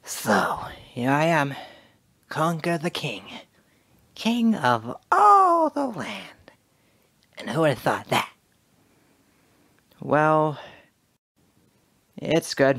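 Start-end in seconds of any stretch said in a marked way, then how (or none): 0.0-8.3 cocky squirrel king, pitch climbing; Conker voice. Shown as a demonstration. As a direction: build to a grand high boast as King Conker. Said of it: "Now I can do better than that".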